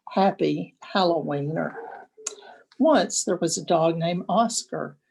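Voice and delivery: usual speaking voice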